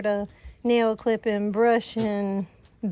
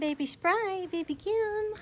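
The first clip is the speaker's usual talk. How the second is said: much higher, soft sing-song calling; high soft coo; 10 semitones higher, 6 dB quieter, slower than usual. Real, not acted. Owner softly calling her baby goats by name, high coo.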